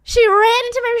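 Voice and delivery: normal talking voice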